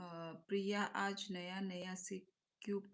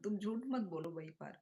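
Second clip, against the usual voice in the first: normal talking voice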